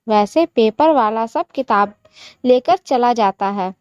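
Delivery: usual speaking voice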